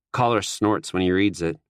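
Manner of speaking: ordinary delivery, nothing marked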